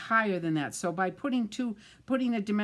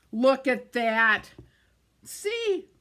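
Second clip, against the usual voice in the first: louder, slow look at that, then a high see; triumphant show-off; 5 semitones higher, 7 dB louder, much slower than usual. Real, not acted. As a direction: proudly reveal the fix. Proudly shows the fixed card.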